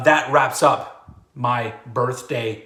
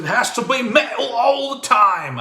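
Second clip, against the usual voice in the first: put-on high, slow British accent, the T in metal dropped; 10 semitones higher, 5 dB louder, slower than usual. British accent slipped in while thanking the old head bangers. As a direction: lapse into a hammy British accent. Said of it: "I just did a British accent there".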